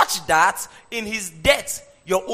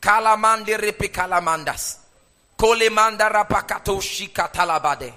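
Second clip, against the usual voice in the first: tongues; 4 dB louder than usual. Real, not acted. Praying in tongues over music.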